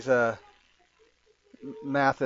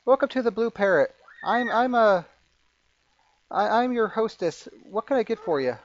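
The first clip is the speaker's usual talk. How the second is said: high-pitched, slightly flustered tavern hostess; 8 semitones higher than usual. Acted. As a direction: greet patrons in a high, stumbling voice. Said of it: "She goes".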